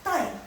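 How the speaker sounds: "tai" starting high and dropping fast; short falling "tai"; fourth tone. Shown as a demonstration. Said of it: "nada keempat".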